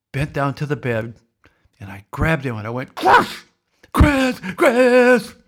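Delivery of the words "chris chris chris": shouting the name high and loud in mock panic; frantic calling. Father waking his sleeping son, high loud mock-panicked calls. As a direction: yell him awake in a staged alarm.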